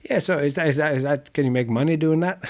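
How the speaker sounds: high-pitched, eager gushing over the circus; enthusiastic gardener. Retold or imitated. Gardener warming to the circus artist, excited high voice. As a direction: play the gardener suddenly thrilled by your job. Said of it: "enthusiastically gone that".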